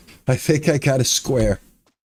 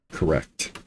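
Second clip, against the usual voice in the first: usual speaking voice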